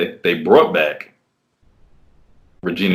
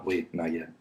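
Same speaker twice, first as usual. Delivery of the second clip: much softer, almost whispered; hushed command; 3 semitones lower, 16 dB quieter than usual. Retold or imitated. Man imitates the woman murmuring softly to hold him back. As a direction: murmur it low and close.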